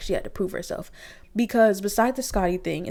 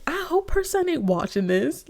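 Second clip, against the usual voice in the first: raised-pitch worry; 9 semitones higher than usual. Retold or imitated. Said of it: "I was just like".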